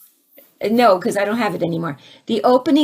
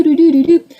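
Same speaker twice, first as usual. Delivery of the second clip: higher, louder sung doo notes; text tone imitation; 7 semitones higher, 8 dB louder than usual. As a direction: hum the message chime. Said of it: "my text message goes doo".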